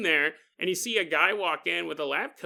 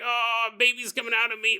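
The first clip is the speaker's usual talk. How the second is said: higher, strained pretend birthing cry; mock labor straining; 5 semitones higher, 3 dB louder than usual. Imitating a woman giving birth, mock labor straining. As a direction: groan it out as if pushing. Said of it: "you're giving birth".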